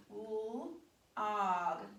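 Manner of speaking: "L" split off, then "og" with a short o; teacher sounding out log. Shown as a demonstration. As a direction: split the onset from "og".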